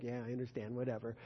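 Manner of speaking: put-on low, soft grudging teen mumble; a sulky child brushing off mom's scolding, grudgingly; dismissive whatever. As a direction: mutter it like a bored kid.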